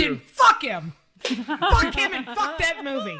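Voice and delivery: loud, high comic indignation; mock outrage. Podcaster dismissing a sequel in loud mock outrage. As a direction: curse it out in exaggerated disgust.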